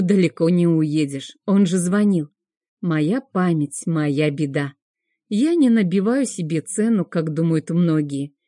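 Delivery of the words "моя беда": ordinary delivery, nothing marked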